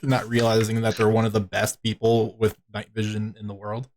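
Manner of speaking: usual speaking voice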